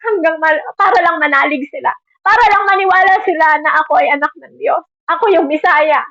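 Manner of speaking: a lightly voiced, pained Jesus asking how long; pleading Jesus. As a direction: plead to the Father, weary of people who won't believe. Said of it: "Parang ganun yung sinasabi ng Diyos".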